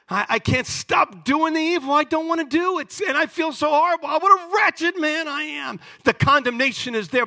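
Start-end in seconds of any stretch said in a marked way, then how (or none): 0.1-5.8 higher, pained voice of a guilty man; anguished Paul. Retold or imitated. Preacher voicing Paul's anguish, pained and high. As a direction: cry it out like a man tormented by his sin. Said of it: "He says".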